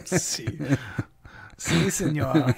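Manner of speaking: hammed-up Spanish accent, slow ending. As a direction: order a beer like a tourist in Spain. Mock Spanish tourist phrases.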